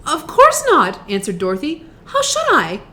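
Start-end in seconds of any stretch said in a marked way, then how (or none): 0.1-1.0 alarmed answer. A narrator's reading. Frightened Dorothy answers the dying witch. 2.1-2.8 alarmed answer. A narrator's reading. Frightened Dorothy answers the dying witch.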